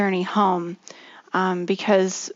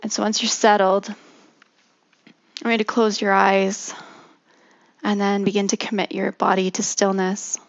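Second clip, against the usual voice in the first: guided meditation. Acted. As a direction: ease them gently into stillness.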